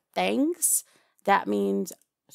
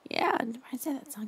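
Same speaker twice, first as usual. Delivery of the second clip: whisper; 6 semitones higher, 6 dB quieter, much faster than usual. Acted. Aside whispered to captive.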